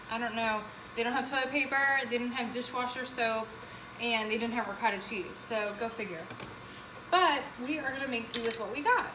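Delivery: normal talking voice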